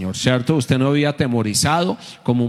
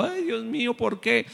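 high, hushed mock whimper; imitated tearful lament; 9 semitones higher, 8 dB quieter than usual. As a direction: whine it small and high, imitating a weepy believer. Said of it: "gente que va así ... van llorando".

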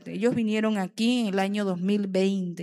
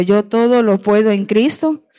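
loud declaration; 11 dB louder than usual. Acted. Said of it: "diga conmigo".

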